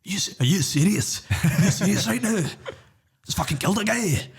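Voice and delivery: hammed-up actor impression, pitched high; Gerard Butler. Co-host mocking the actor's court scene, hammed-up incredulous. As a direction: draw out the disbelief, mocking his American accent. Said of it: "give us your impression".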